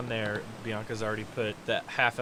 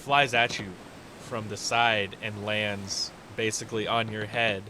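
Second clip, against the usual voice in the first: usual speaking voice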